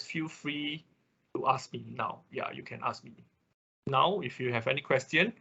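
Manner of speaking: ordinary talk, nothing marked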